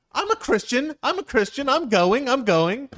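high, loud, hammed-up insistence; upset protest. Friend insists to the couple he's rapture-bound, indignant. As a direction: repeat it as a loud offended protest. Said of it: "he's all upset about it".

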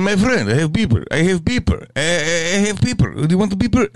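hammed-up Eastern European hustler accent offering a beeper; mock Russian-Armenian accent. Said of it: "some Russian, Armenian, Turkish dude".

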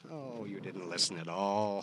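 normal talking voice

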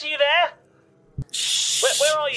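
high, urgent calling voice; worried caller. Acted. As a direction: call out anxiously for Liz. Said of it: "it says, uh".

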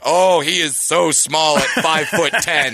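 slow, mock-pitying sports commentator; mock announcer. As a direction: lay on fake sympathy, slow and drawn out. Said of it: "hear the announcers be like".

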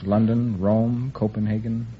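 normal talking voice

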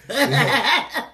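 loud, high laughing words; words swallowed by a big laugh. Real, not acted. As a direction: burst out laughing as you start. Laughing while answering.

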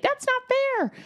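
high, whiny protest; mock whiny yell. Woman in the parking lot yelling at the driver, high and whiny. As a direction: squeak it out like a wronged kid. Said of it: "She might yell at me".

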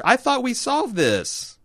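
high, drawn-out plaintive protest; slow, high-pitched bafflement; exasperated disbelief. Real, not acted. Baffled protest in a playful quarrel.

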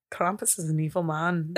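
low, soft Irish-tinged voice; still Irish. Acted. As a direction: attempt the title and slip into Irish.

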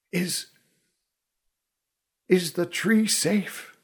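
soft, slow halting question; weak dying voice. A narrator's reading. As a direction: struggle to get the words out. Dying archer coughing blood.

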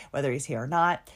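ordinary delivery

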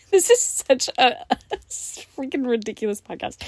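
laughing through the words; giggling at her own podcast. Real, not acted. Laughing at herself.

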